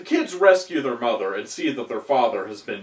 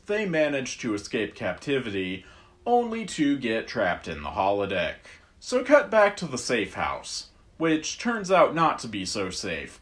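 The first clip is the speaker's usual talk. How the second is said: usual speaking voice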